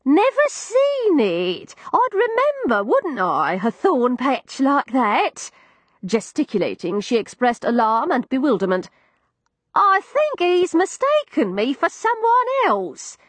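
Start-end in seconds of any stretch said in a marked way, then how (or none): 0.0-5.5 put-on high, slow old woman, puzzled; a deaf old lady, bewildered; old landlady voice. 9.8-13.2 put-on high, slow old woman, puzzled; a deaf old lady, bewildered; old landlady voice.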